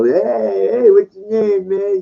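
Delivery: high, bright bro greeting; cheery "hey man" pickup voice; happy pickup guy. Retold or imitated. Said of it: "they say, "Oh, be, like, kind of the happy".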